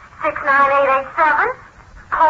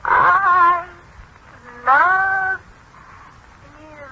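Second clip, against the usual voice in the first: higher, drawn-out words with long gaps; spaced-out, raised machine voice; halting machine repetition; 4 semitones higher, much slower than usual. Acted. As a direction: sound out each word slowly and more confidently. Machine tries the phrase again.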